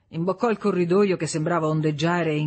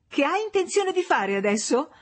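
high-pitched taunting question; mocking; 11 semitones higher than usual. A narrator's reading. Wife taunting her husband as he rages, mocking. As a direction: jeer at him in a much higher voice. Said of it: "lo burlò lei".

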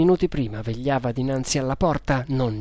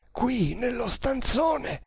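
high, slow, softer call; calling back; 10 semitones higher, 4 dB quieter, slower than usual. A narrator's reading. Nagor answers from inside the locked room, calling back. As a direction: answer slowly in a high voice, as from behind a door.

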